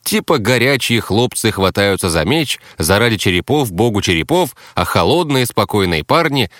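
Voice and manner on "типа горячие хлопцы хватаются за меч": ordinary delivery, nothing marked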